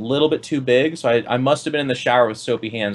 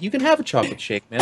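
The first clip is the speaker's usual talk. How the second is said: confident friend voice; 6 semitones higher than usual. Retold or imitated. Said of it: "He's like".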